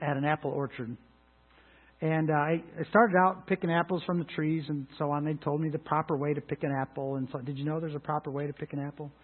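ordinary delivery

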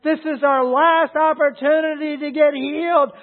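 acted high, loud begging; desperate plea. Preacher voices blind men begging Jesus for healing, desperate plea. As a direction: cry out as if it were the last chance. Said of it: "It was a desperate plea".

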